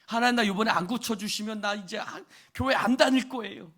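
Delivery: mock threatening prayer, ending loud; 협박하듯 기도. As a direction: bargain with God, pushing up to a loud threat. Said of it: "협박 같은 기도들 할 때 있죠". (협박하듯 목소리를 높이는 기도)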